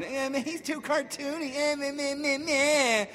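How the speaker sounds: high whiny mocking voice; mocking whine. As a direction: sneer like a nagging critic.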